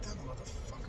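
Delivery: under-the-breath curse, barely voiced; a whispered swear at a passing truck; muttered curse. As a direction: spit it out under your breath. Cursing the driver under his breath.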